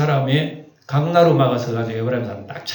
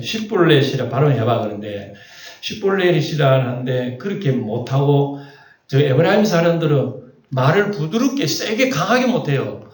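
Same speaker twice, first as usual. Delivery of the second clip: usual speaking voice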